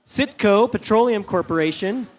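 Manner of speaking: normal talking voice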